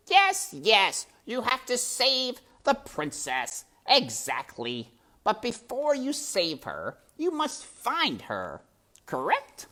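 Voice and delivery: high, purring villain rat, mockingly weary; sly rat voice. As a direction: play the sly rat toying with the mouse. Rat Botticelli's voice in story.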